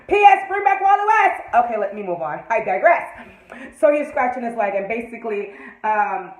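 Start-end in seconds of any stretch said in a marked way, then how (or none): 0.1-1.4 loud high fan demand; loud plea. Real, not acted. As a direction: shout a lot higher and louder. Demanding to producers.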